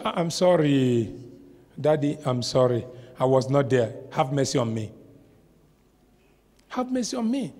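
mild pleading. Acted. Job pleading, voiced low.

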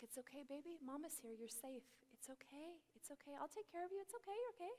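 imitated motherly murmur, tender and quiet; soothing mother. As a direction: hush and reassure a crying child. Mother soothing her crying child.

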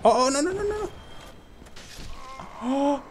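high-pitched panic "no, no"; panicked high cry. Real, not acted. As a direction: shriek in alarm as it goes wrong. Panics as he is hit.

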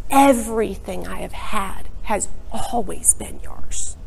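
soft whispered reassurance from the father; unvoiced, hushed father's reply; gentle whisper. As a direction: breathe it to him tenderly. Father answers the son.

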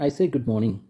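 ordinary talk, nothing marked

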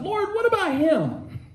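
high, curious question to the Lord; Peter asking. Acted. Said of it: "he asked".

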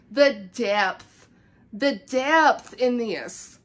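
"depth" leaned on and drawn out; awed emphasis. Real, not acted. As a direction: dwell on "depth", stretching it slowly in admiration. Marvelling at the book.

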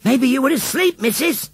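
put-on high-pitched little boy calling out; cheeky call from the crowd; boy's shout. A narrator's reading. Little Tommy taunts the old lady, shouting. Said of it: "shouted little Tommy Higginsthwaite".

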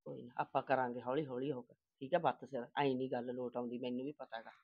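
low, soft, hushed reassurance; hushed soothing. Acted. Mother quietly calming her daughter, hushed and low.

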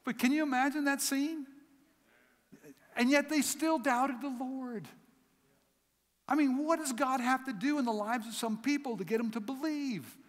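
high, loud, impassioned preacher's cry; full-force preaching. Acted. Preacher pleading with the congregation in full force. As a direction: lift the congregation with the Lord's name.

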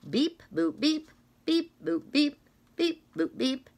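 high chirpy beep-boop noises; scanner beeps. Acted. Reader mimicking the book scanner, playful beeps. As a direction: play the checkout scanner.

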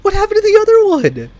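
high, squealed question through laughter; incredulous, laughing high-pitched questions; laughing disbelief. Real, not acted. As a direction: ask it cracking up, voice shooting high. Baffled, laughing at vanished driver.

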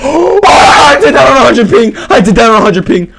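high, loud triumphant shout; excited yell. Real, not acted. Shouting to viewers after a big kill. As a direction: yell in disbelief at the play.

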